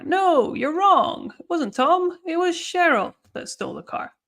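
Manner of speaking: high, insistent contradiction punching "Tom" and "Cheryl"; firmly contradict, stressing "Cheryl" over "Tom"; emphatic correction. Shown as a demonstration. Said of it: "put emphasis on Cheryl".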